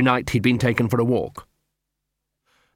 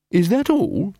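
high-pitched questioning character voice; unimpressed question; 8 semitones higher, slower than usual. Acted. Angua unimpressed by explanation.

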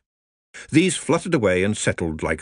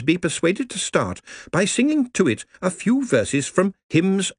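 prim, higher voice for a lady librarian; 8 semitones higher than usual. Acted. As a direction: speak up as a proper lady. Marjorie's long speech.

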